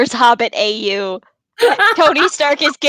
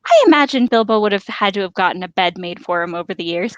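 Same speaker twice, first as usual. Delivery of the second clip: ordinary delivery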